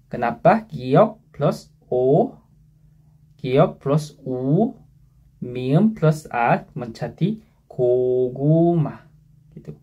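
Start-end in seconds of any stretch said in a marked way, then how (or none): 0.7-9.0 very slow, letter by letter; slow spelling out. Shown as a demonstration. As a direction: spell each part out slowly. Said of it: "spelling out syllable parts".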